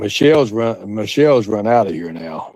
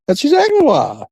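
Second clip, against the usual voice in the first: high gleeful taunt. Real, not acted. Mocking Bob.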